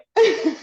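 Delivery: a sudden high, loud burst of laughter; emotional laugh. Real, not acted. Guest moved by the host's offer, bursting into a high laugh. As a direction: laugh out in relief as the emotion spills over. Overcome after generous offer.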